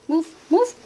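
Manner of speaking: repeated nudging command to a pet; coaxing command. Real, not acted. Telling her dog Dio to get out of the way, coaxing. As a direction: push the dog along, rising.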